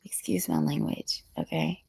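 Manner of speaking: whispered aside; whispering. Real, not acted. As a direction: drop to a whisper to apologise.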